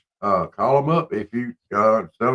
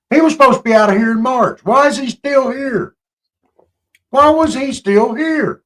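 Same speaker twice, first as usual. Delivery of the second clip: indignant outrage; 12 semitones higher, 4 dB louder than usual. Real, not acted. Outraged at overstayed visa.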